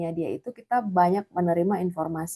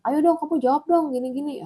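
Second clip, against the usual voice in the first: a hammed-up pushy question; pushy demand; 8 semitones higher than usual. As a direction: nag the client like an impatient salesman. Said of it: "Ya jangan".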